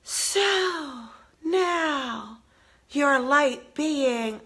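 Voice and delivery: slow, soft, breathy guru voice; breathy meditation voice. Retold or imitated. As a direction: mimic a syrupy meditation guide, slowly. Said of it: "there's some breathy voice saying".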